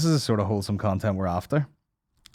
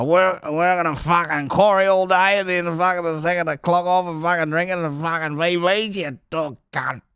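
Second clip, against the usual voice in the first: mock Aussie bloke; 7 semitones higher than usual. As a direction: brag like a rough Aussie quarry worker. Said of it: "Australia must just be full of".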